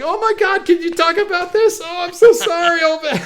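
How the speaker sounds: very high, loud mock sympathy; over-the-top fussing in a high, loud voice; hammed-up pity. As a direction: gush with exaggerated concern, way up high. Mimics characters hanging off Picard.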